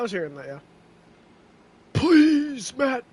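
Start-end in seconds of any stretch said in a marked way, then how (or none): 1.9-3.0 loud desperate plea, high-pitched; pleading shout. Real, not acted. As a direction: beg him at full volume. Begging the driver, Matt.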